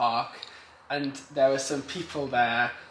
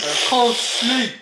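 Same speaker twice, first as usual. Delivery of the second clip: mock huffy whine; 6 semitones higher, 4 dB louder, slower than usual. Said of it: "she then came huffing downstairs".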